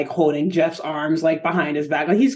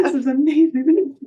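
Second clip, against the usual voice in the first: higher, delighted gushing; thrilled Jeff; 7 semitones higher than usual. Retold or imitated. Jeff admiring his makeover, higher delighted gush. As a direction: gush over a new look.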